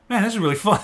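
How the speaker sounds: high-pitched quoted enthusiasm; delighted reaction. Retold or imitated.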